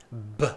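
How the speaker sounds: a single soft B; isolated B sound. Shown as a demonstration. Teacher repeating the B for students, soft pop. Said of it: "One more time".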